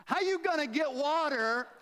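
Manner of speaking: high, indignant sassy woman; sassy incredulity. Acted. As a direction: scoff loudly at an absurd offer. Acts out woman's incredulous retort to Jesus.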